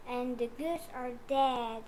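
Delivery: usual speaking voice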